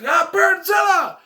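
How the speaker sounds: high, loud, slow panicked cry; hammed-up fright at a huge bird; mock scared yell. The dog meets the giant turkey, hammed-up, high, loud scared yell.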